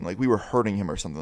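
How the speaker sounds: ordinary talk, nothing marked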